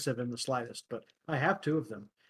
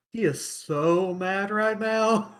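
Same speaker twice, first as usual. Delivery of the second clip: slow, higher-pitched, stretched on "so"; stunned glee; 5 semitones higher, 4 dB louder, much slower than usual. Retold or imitated. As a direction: draw it out with amused disbelief. Retells own stunned gleeful thought.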